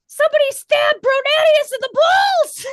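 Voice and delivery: high, loud panicked shout; shouting townsperson. Acted. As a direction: yell it down the street. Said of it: "a young person go".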